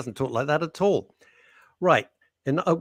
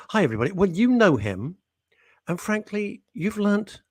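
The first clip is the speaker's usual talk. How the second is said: ordinary talk, nothing marked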